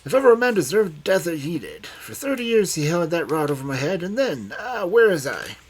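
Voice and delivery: put-on high, loud, vehement old man; excited outburst. A narrator's reading. Old man condemning his dead tormentor, excited. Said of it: "springing up excitedly from his chair".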